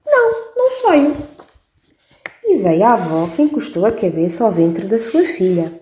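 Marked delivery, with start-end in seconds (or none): 0.1-1.2 put-on much higher little baby voice. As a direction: squeak a firm refusal like a baby. Baby refusing.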